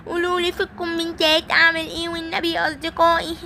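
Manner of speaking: high, whimpering, hammed-up sobs; mock crying toy. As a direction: wail about being lost, high. Simba alone, fake crying. (بكاء تمثيلي بصوت عالٍ)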